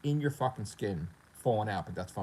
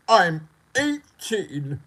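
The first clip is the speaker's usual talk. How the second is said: loud, high, very drawn-out whine; hammed-up stretched complaint; mock dismay; 7 semitones higher, 8 dB louder, much slower than usual. Player who slipped down the rankings, mock dismay. Acted player wailing new rank.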